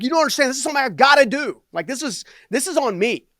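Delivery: high, loud, urgent insistence; insistent pleading. Retold or imitated. Arguing back with his angry dad.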